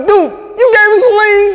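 high, loud, dragged-out mock shout; yelling it up at the stage. Mock yelling at Thug for missing lines.

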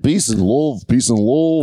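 put-on Liverpool accent.

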